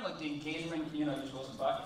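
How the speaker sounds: normal talking voice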